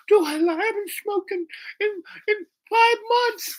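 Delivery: very high, stammering falsetto; hammed-up eagerness, tripping over the words; overexcited girl. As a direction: imitate a girl beside herself at the offer. Girl answers marijuana offer.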